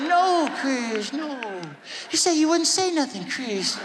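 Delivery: high, plaintive reproach. Retold or imitated. Star reminding [name] of his promise, hurt. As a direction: scold in a gentle high voice. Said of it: "And [name] was like".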